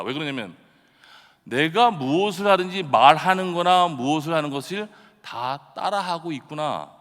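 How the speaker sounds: normal talking voice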